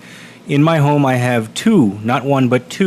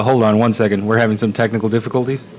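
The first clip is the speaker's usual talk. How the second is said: normal talking voice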